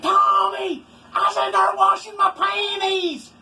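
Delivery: high, loud mock yell of a frantic woman; woman yelling after him. Woman runs after Tommy's truck, high, loud mock yell. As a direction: squeal it like she's chasing the truck. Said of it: "and she said".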